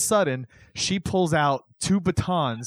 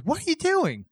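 much higher, sharp "What are you doing?" in a woman's voice; startled woman; 13 semitones higher than usual. Retold or imitated. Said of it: "she goes".

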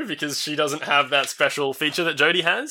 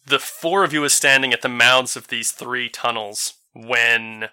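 ordinary delivery

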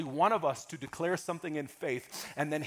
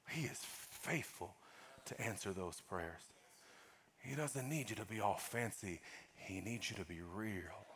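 much softer, intimate preaching; hushed sermon voice; 10 semitones lower, 18 dB quieter than usual. Real, not acted. Pastor softly reassuring the congregation after a prayer, hushed. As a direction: bring the room in close and gentle.

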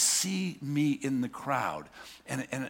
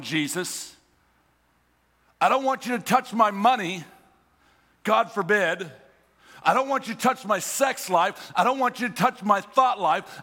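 higher, louder pleas, pushing him off; urgent pleading; 5 semitones higher, 4 dB louder than usual. Retold or imitated. A believer begging Jesus to leave his life alone, urgent pleading. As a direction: hold Jesus back from your stuff.

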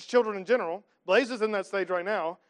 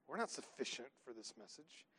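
ordinary talk

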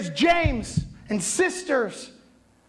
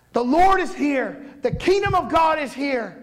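raised, higher call across the desert; 5 semitones higher than usual. Acted. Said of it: "he's calling out".

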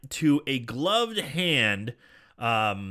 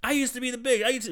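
pitched way up in offended protest; indignant protest; 10 semitones higher, faster than usual. Retold or imitated. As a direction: squeal it like a wounded has-been. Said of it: "And he's like".

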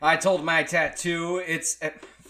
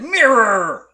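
a loud, hammed-up bark of one word; angry shout; 5 semitones higher, 12 dB louder than usual. Reading the tattoo guy's post, he yells for a mirror loudly. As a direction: demand the mirror, loud and furious. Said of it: "Angry, I said".

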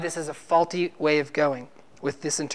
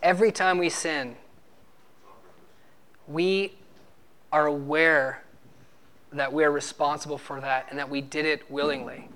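usual speaking voice

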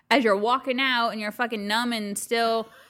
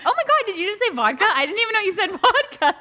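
excited mock reaction; 9 semitones higher, 6 dB louder than usual.

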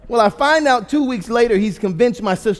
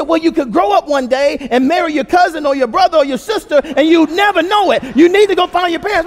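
sustained high, loud rant as dad; imitated dad yelling; 8 semitones higher, 7 dB louder than usual. Dad warning his kids they could marry a cousin, yelling. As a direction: holler the warning without letting up. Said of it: "he starts yelling at us".

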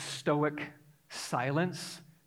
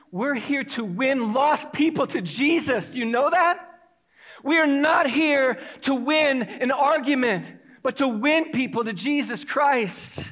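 loud, high, emphatic sermon delivery; impassioned preaching; 6 semitones higher, 5 dB louder than usual. Real, not acted. Preacher urging church to win lost people to Jesus, impassioned preaching. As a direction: drive each point home with raised voice.

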